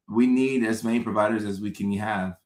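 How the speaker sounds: ordinary delivery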